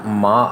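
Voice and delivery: माँ said with the pitch climbing; rising second tone. Shown as a demonstration. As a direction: let माँ rise like a question. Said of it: "आवाज़ बढ़ रही है".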